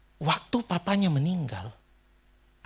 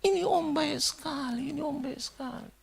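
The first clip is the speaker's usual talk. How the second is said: slow, high repeated mourning refrain; imitated tearful lament; 11 semitones higher, 4 dB quieter than usual. Stranger repeating praise of the dead, imitated slow high weeping. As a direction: mimic weeping praise, fading softer at the end. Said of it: "Dia ucapkan itu berulang-ulang".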